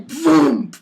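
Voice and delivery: loud, high vocal crash noise; imitated impact boom. Imitates the thrown rock's impact.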